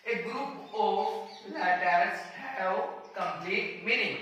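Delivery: very slow, word by word; slow dictation. Shown as a demonstration. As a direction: dictate it slowly for notes. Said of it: "उसे बोलते हैं word".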